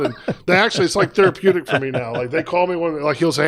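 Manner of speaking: normal talking voice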